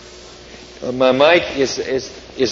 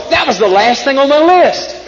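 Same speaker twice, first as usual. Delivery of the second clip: high, delighted storybook voice; excited Toad; 10 semitones higher than usual. A narrator's reading. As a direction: Toad thrilled to find his last task. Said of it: "shouted Toad".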